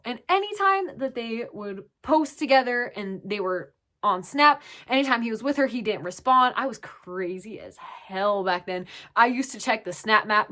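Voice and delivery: ordinary delivery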